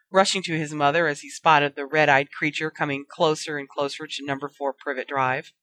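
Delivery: ordinary delivery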